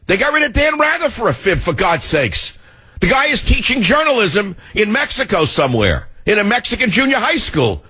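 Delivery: high-pitched incredulous protest; indignant rant. Real, not acted. Host protests an anchor fired for a fib, exasperated and high. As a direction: rail at it with the pitch way up.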